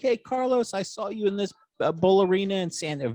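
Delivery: imitating dad, high and eager; excited dad. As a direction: gush like an excited fan telling his story. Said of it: "very excited about this story".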